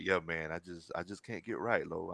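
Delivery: low, very soft, dejected imitation; depressed. Friend imitates slumping player on the phone, mock dejected. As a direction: mumble it like a beaten-down buddy. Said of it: "he like".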